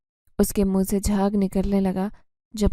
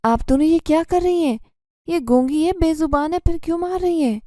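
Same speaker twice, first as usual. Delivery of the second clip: loud, much higher protest; indignant angry shout; 7 semitones higher, 3 dB louder than usual. A narrator's reading. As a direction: stop the beating with an outraged cry. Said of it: "غصے سے کہنے لگا".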